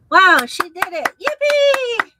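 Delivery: "Yippee" squealed very high; gleeful cheer. Real, not acted. Cheering the woman in clip.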